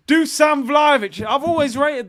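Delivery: high, loud celebration of the scorer; gleeful acclaim. Real, not acted. Host celebrating Vlahovic's goal, gleeful acclaim. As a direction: sing his praises loudly and excitedly.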